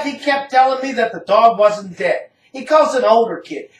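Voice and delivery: plain reading voice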